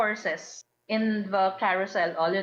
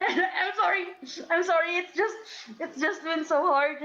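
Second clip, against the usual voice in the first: mock sobbing; 7 semitones higher than usual. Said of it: "she continues to sob".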